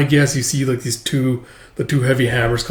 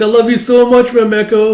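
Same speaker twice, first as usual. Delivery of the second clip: high, loud gushing; little kid gushing thanks; gushing child; 10 semitones higher, 7 dB louder than usual. Acted. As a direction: gush with delight.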